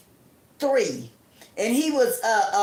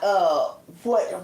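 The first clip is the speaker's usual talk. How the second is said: normal talking voice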